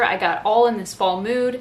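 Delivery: ordinary delivery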